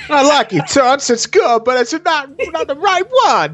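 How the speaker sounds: high, hammed-up New York mobster voice; 1920s gangster. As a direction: play a cheesy twenties gangster flirting. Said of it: "New York gangster from the twenties".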